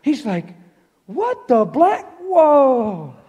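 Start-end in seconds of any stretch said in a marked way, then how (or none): 1.1-3.1 high, slow amazed exclaim with a laugh; astonished whoa. Retold or imitated. The soldier feels his knee healed, amazed and laughing. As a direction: let the disbelief spill out high and slow.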